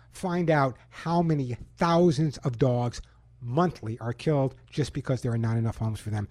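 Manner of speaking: ordinary talk, nothing marked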